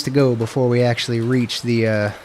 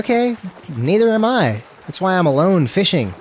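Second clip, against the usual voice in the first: higher-pitched, exaggerated comeback; mock retort; 6 semitones higher than usual. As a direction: answer back in mock indignation, pitched up. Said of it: "It's like".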